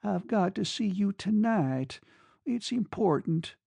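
begging him, voice barely steady; unsteady, pleading. A narrator's reading. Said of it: "came an unsteady voice".

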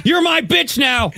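high, loud mock-tough shout. Mock threat to the kids, hammed-up loud shout. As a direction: bark it out laughing at the bit. Joking menacing line to kids.

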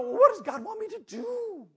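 high, whiny-sounding mimicry; mock pleading question. Preacher mimicking people who want God's plan, mock pleading. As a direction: plead it like someone waiting to be told.

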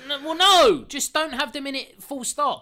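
loud, high exasperated rant; exasperated shouting. Real, not acted. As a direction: shout it down in frustration. Ranting about the trash people.

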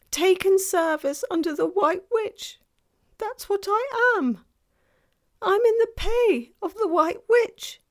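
high, loud, wailing self-reproach; distraught faun. A narrator's reading. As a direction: lament loudly as a guilt-stricken faun.

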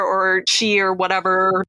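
ordinary talk, nothing marked

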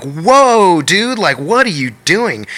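high, startled protest; retold shock. Storyteller as glass shatters in hand, re-enacted shock.

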